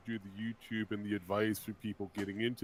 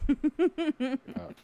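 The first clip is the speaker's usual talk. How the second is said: high squeaky pitched-up word; voice changer; 5 dB louder than usual. Acted. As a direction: soundboard chipmunk effect. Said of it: "my new buttons".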